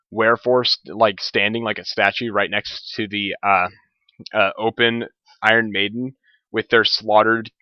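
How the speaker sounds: normal talking voice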